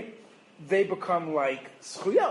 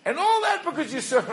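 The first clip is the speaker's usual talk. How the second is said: laughing mock blame; 9 semitones higher than usual. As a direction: crack up mid-rant, pitched right up. Comic escalating blame, laughing.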